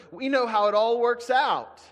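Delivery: ordinary talk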